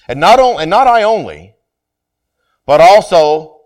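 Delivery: slow, weighty reading of scripture. Acted. Pastor reading the epistle to his congregation, measured and solemn. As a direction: lay each phrase out for the church.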